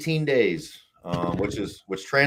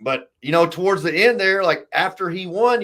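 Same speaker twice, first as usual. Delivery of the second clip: usual speaking voice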